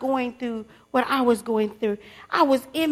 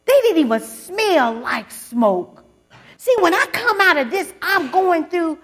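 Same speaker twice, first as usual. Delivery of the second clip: louder and higher, punching each phrase; preacher rousing the congregation, loud and rising; preaching at full force; 4 semitones higher, 6 dB louder than usual. Real, not acted. Preacher building to a climax.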